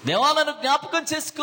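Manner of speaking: pleading cry. Retold or imitated. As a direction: beg out loud. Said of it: "తగ్గింపుతో దేవుని దగ్గర బ్రతిమాల". (బిగ్గరగా బ్రతిమాలుతూ)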